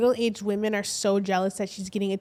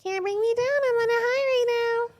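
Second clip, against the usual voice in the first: much higher, sing-song mocking voice; 13 semitones higher than usual. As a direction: sneer it out high and giddy. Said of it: "she's like".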